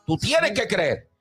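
high, driving preacher's cry; fiery preaching. Acted. Pastor exhorting the church to pray, fervent preaching. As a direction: preach it at full force to the congregation.

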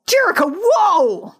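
high, drawn-out mock shock; startled exclamation. Recapper as Misfits spying on the mansion, high startled cry. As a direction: call out as if suddenly stunned.

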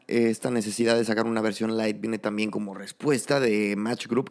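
ordinary talk

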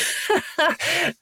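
giggle, a little flustered; embarrassed giggle. Real, not acted. Giggling about the handsome whipper.

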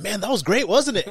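hammed-up clueless comic boasting; oblivious excitement. Oblivious comic after bombing.

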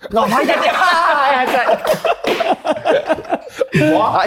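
high, helpless laughter breaking through the words; laughing. Real, not acted. Teased pundit laughs helplessly at his mates' ribbing. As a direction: crack up mid-question, laughing hard.

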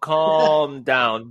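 CALM stretched out very slowly; slow, deliberate CALM, then DOWN; practised pronunciation. Shown as a demonstration. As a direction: draw out "calm" to work on its L. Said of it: "The, the L for me".

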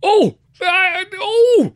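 very high, loud apoplectic squawks; splutter, too furious for words; outraged father lost for words; incoherent spluttering anger. A narrator's reading. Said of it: "my father spluttered, incoherent with anger".

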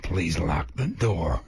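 low, slow gangster drawl. Pretend. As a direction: say it low and slow, De Niro style. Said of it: "in your kind of gangster voice".

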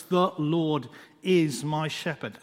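slow, measured words with room between them; slow, prayerful reading of the verse. Shown as a demonstration. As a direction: read it slowly and let each word land. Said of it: "as you read them slowly".

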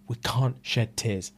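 slow, deliberate resolve; steely composure. Retold or imitated. Woman pulling herself together, slow steely resolve. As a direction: draw it out like a stern boss.

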